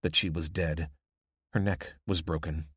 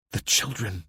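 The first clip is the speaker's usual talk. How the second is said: usual speaking voice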